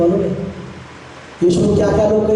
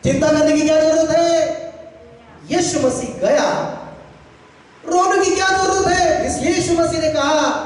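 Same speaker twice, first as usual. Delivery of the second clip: high, ringing address to the congregation; impassioned preacher's declaration; preaching; 9 semitones higher than usual. Real, not acted. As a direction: proclaim it on a raised sustained pitch. Preaches at raised pitch.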